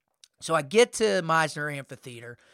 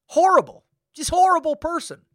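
much higher and louder, spitting out horrible; exclaimed disgust; 11 semitones higher, 9 dB louder than usual. Real, not acted. Venting to listeners about the rude coordinator, loud disgusted exclamation. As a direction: burst out with it, fed up.

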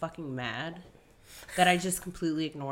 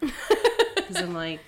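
laughing; 6 dB louder, much slower than usual. Real, not acted. Laughing while retelling anger.

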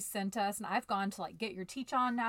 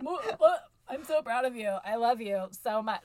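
forced awkward affection; 2 semitones higher, 7 dB louder than usual. Retold or imitated. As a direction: choke out the compliment like it makes you ill. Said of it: "I wanna throw up when I say things like".

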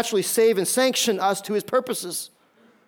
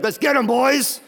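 higher, eager gang-leader rally; rally the boys to go after them; rallying ringleader; 5 semitones higher, 3 dB louder than usual. Acted. Saul urging on his little cronies, rallying.

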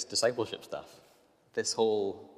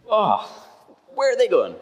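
much higher, louder, exasperated wail; exasperated whine; 13 dB louder, slower than usual. Retold or imitated. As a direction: throw the question up in despair. Exasperated at slow walkers.

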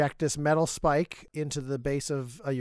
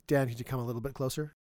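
usual speaking voice

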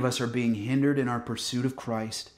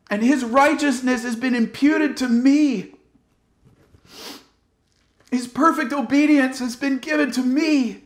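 loud, higher, fervent preaching; preaching at full force; 9 semitones higher, 6 dB louder than usual. Acted. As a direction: proclaim it with rising fire to the congregation.

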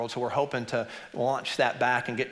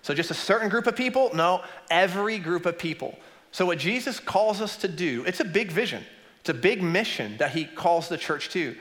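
fiery preaching; 6 semitones higher than usual. Real, not acted. Preaching at full force.

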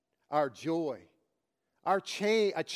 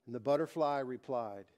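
normal talking voice

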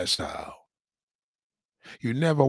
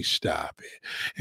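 whisper; 9 semitones lower, 7 dB quieter than usual. Real, not acted. Aside while joking about himself.

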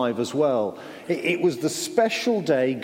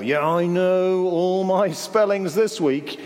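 slow, drawn-out boast, pitched higher; bragging; 4 semitones higher, slower than usual. Pretend. Imagined child boasting about schoolwork, mock bragging. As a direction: show off with stretched-out mock confidence. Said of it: "Have you ever bragged about something".

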